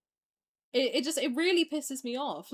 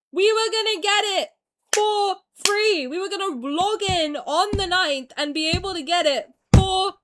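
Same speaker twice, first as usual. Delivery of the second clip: loud, shouting, frustrated yelling; furious rant; 4 semitones higher, 7 dB louder than usual. Real, not acted. Streamer yelling at the fans who got him cancelled, furious. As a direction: rant hard at the people who ruined it.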